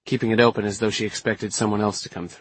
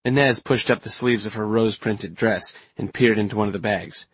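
ordinary delivery, nothing marked

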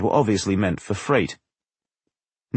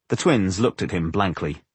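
ordinary delivery, nothing marked

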